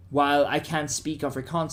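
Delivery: ordinary talk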